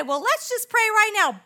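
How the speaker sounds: a bold shout of let's pray; eager call to pray. Retold or imitated. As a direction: fire them up to pray right now. Urging the group to pray.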